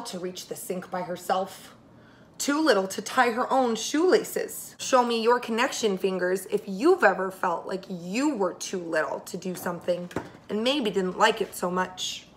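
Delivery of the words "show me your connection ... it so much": plain reading voice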